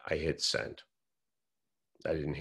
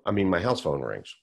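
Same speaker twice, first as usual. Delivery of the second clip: ordinary talk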